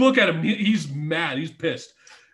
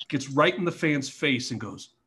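ordinary delivery

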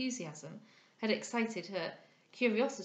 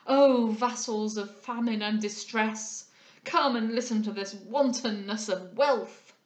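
slow, loud oratorical summons; loud exclamation; 8 dB louder, slower than usual. A narrator's reading. Old moralist summoning the poor against the rich, loud and declamatory. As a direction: call the starving to witness, drawing out the words. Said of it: "again exclaimed the incognito".

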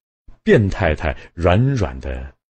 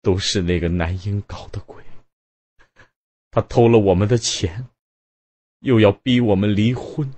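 trembling voice; 4 semitones higher than usual. A narrator's reading. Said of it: "颤颤地说".

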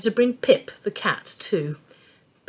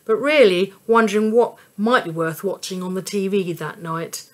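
usual speaking voice

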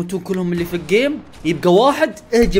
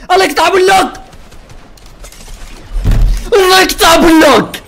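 much higher, loud yell of frustration; furious shout; 13 semitones higher, 10 dB louder than usual. Real, not acted. Gamer cursing his bad luck, furious shout. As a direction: scream it at the game.

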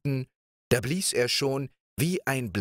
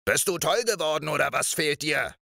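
high, fast startled shout; angry shout; 7 semitones higher, faster than usual. Acted. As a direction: yell at her for hitting him awake.

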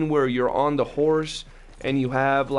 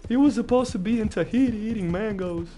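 much higher, incredulous complaint about Arthur; mock outrage; 6 semitones higher than usual. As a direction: whine in disbelief at the ending. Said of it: "I was like".

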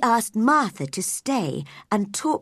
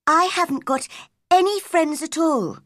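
put-on high-pitched girl's voice; young girl; 8 semitones higher, 4 dB louder than usual. A narrator's reading. Mary tells the gardener of her friendlessness, in a high girl's voice.

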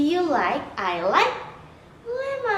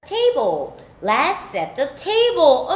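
ordinary talk

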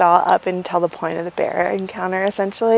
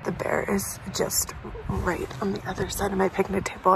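hushed whisper, bear close by; 7 dB quieter than usual. Real, not acted. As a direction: whisper so the bear won't hear.

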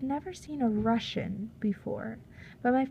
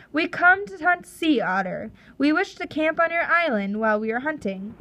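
a lightly voiced Russian captain, higher and a bit louder; 6 semitones higher, 4 dB louder than usual. Russian captain telling the chief his terms, lightly voiced and confident. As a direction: press the bargain as a confident sea captain. Captain Orlov demands camping rights.

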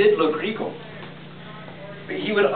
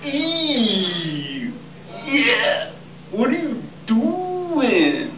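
disgusted Morats; 7 semitones higher, much slower than usual. Acted. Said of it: "they said".

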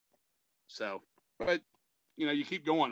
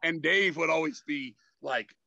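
ordinary talk, nothing marked

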